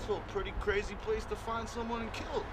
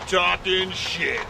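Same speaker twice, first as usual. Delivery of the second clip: slow catchphrase; 11 dB louder, much slower than usual. Acted. Show catchphrase drawn out.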